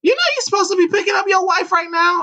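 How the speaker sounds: high put-on old lady voice; high-voiced older lady chiding; older neighbor woman. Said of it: "She's a little older".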